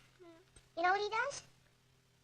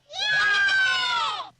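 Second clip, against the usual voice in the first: loud cheer; 14 semitones higher, 7 dB louder than usual. Acted. Kids cheer again louder.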